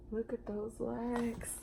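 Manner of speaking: soft, slow amazed aside; hushed awe at huge legs; awed hush. Acted. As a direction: breathe it out in wonder. Awed reaction to dinosaur.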